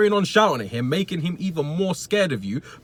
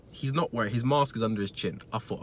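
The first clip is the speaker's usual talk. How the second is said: ordinary delivery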